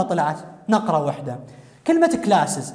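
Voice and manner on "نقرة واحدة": ordinary delivery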